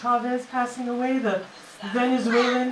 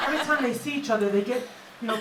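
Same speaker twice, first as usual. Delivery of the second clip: ordinary talk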